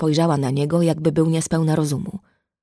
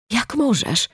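anguished, raised-pitch reproach; pained cry; 6 semitones higher, slower than usual. A narrator's reading. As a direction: cry it out in pain, restrained. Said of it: "krzyknęła z bólem Alicja".

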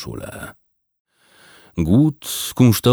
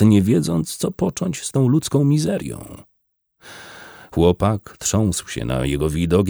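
plain reading voice

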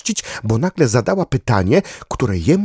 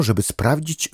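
plain reading voice

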